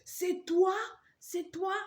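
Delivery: high shrill cry of shock; mock shriek. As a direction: shriek it like the horrified mother-in-law. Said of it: "Elle a crié". (cri aigu imité)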